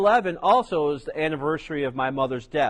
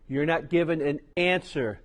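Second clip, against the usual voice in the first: ordinary talk, nothing marked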